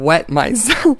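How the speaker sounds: high, loud, breaking into laughter; real laugh bursting through. Real, not acted. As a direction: crack up on the words. Laughing over a misheard word.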